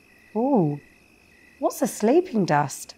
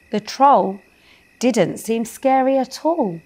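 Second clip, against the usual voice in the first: ordinary delivery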